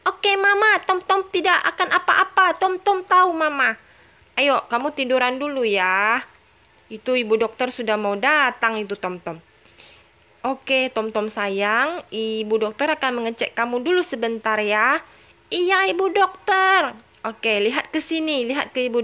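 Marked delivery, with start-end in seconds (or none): 0.1-3.8 put-on high childlike baby voice; high-pitched little baby talking. Baby Tom Tom reassures mama. 15.5-17.0 put-on high childlike baby voice; high-pitched little baby talking. Baby Tom Tom answers doctor.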